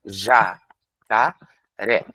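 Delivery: "Ja, ca, ré" with a pause after each syllable; teacher showing children the syllables of a word; split into syllables. Shown as a demonstration. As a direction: chop "jacaré" into three beats. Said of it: "eu posso segmentar jacaré em sílabas".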